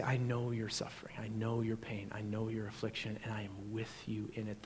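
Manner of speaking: very soft, low, comforting voice; hushed and low, consoling the hurting; tender reassurance. Acted. Voicing Jesus comforting the suffering.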